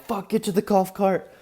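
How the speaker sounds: high-pitched retold call to flee; urgent alarm.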